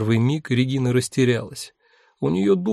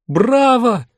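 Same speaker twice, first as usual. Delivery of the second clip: loud cheering shout; 11 semitones higher, 6 dB louder than usual. A narrator's reading. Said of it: "закричала Линда".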